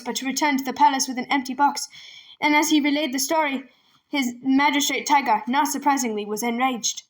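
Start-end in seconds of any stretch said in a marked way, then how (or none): none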